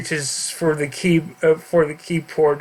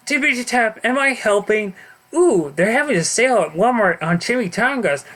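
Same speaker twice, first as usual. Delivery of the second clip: silly Deadpool voice; 6 semitones higher than usual. Acted. Deadpool typing, silly high voice.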